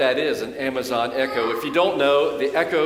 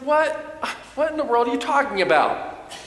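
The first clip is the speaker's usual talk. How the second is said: baffled; 9 semitones higher than usual. Retold or imitated. Preacher retelling his confused reply to his son, high baffled tone. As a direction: react in sudden bewilderment. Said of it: "I said".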